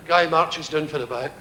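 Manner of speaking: ordinary talk, nothing marked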